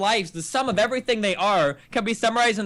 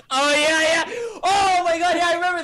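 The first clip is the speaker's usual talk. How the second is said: laughing outburst; 8 semitones higher, 3 dB louder than usual. Real, not acted. Remembering sponsor harassment, laughing.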